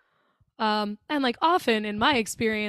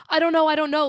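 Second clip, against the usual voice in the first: higher, louder retold panic; mock panicked; 5 semitones higher, 5 dB louder, faster than usual. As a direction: imitate her too scared to answer. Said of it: "she's so scared".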